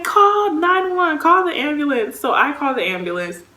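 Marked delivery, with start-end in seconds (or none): 0.0-2.2 high, urgent retold command; imitated high-pitched urging; urgent cousin. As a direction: act out a relative urging someone to phone for help. Acted cousin urging a 911 call.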